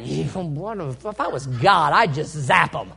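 slow, low mock-smug voice; a touch of smug vengeance; smug would-be God. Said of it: "you ever heard somebody say that".

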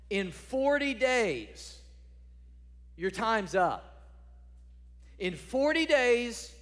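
a prophet crying doom over a city; proclaiming doom. Acted. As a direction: thunder it out, word by word. Jonah's prophecy, preached out loud.

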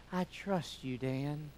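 soft, pretend voice of the trusting dog; dog's trusting voice. Pastor voicing his golden retriever, soft and trusting. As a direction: give the dog a quiet trusting line. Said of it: "he just stands there and goes".

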